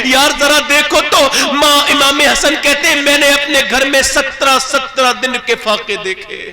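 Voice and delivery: high, loud impassioned sermon; fervent preaching. Acted. Preacher scolding the daughters of today, fervent and loud. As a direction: rebuke the audience with rising fervour.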